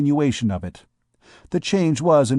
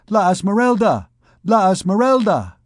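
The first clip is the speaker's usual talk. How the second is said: high, loud calling of her name; crowd cry; 8 semitones higher, 4 dB louder than usual. Acted. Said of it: "a cry arose".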